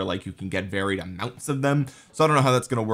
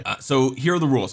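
ordinary delivery

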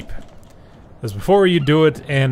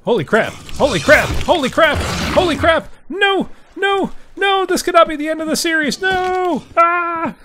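rising panic, climbing much higher each time into a shriek; panicked screaming; 4 dB louder than usual. Real, not acted.

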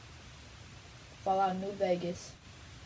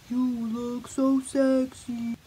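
loud, slow, drawn out; sing-song self flattery; seductive; 3 semitones higher, 7 dB louder, much slower than usual. Pretend. Repeat seductive, louder.

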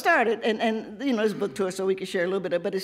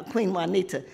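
ordinary talk

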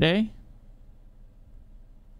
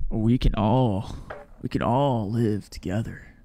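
Rick Grimes impression; 7 semitones lower than usual. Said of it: "That was a horrible attempt".

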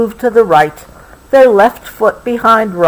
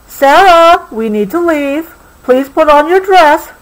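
put-on high, loud call to a child upstairs; mother calling upstairs to her daughter; calling up the stairs; 8 semitones higher, 3 dB louder than usual. A narrator's reading. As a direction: call her from downstairs, raised voice. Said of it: "Sarah's mother yelled up the stairs".

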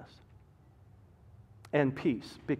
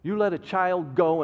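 ordinary delivery

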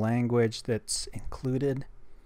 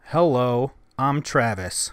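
louder test phrase spoken into the phone; loud dictation; 8 dB louder, slower than usual. Shown as a demonstration. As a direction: say your name loudly into the app. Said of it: "So let's try this".

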